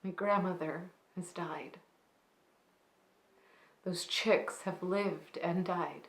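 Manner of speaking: soft, hushed dramatic monologue with long pauses; monologue de souvenir endeuillé. Acted. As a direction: confide a grandmother's story quietly, letting sentence endings sink away. Performs a reflective, grieving monologue.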